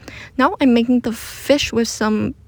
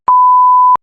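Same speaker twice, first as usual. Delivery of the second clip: quick bright burst of laughter; short laugh; 11 dB louder than usual. Real, not acted. Hot under the blanket, laughing.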